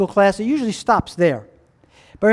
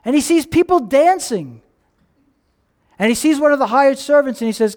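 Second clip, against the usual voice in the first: normal talking voice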